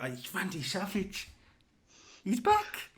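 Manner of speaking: high-pitched excited squeal; fanboy glee. Real, not acted. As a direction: gush like a thrilled fan. Fan thrilled at return.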